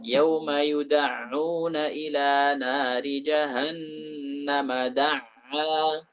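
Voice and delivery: slow recited yuda'una with a full ain; Quranic recitation. Shown as a demonstration. Teacher reciting a Quran verse as a model, slow recitation. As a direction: chant it slowly, giving the ain its full weight. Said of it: "ain itu ditasdidkan".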